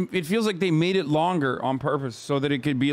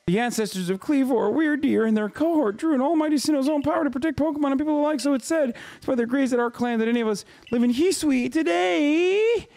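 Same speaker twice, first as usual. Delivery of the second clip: higher-pitched reading of a clan elder's lore; lightly voiced storyteller character, pitched up; game character voice; 7 semitones higher than usual. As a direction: read the lore in a raised character voice. Reads game character dialogue aloud.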